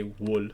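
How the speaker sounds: "wool" with a short oo; regional pronunciation. Shown as a demonstration. Player quoting some regional speakers, "wool" with short oo. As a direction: say "wool" with the vowel of "good". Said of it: "somebody still probably would say".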